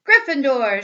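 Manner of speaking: a big announcing shout of the house name; hat shouting. Acted. The Sorting Hat announces the house to the hall, shouting. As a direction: call it out loud to the whole hall. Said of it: "shouted the hat".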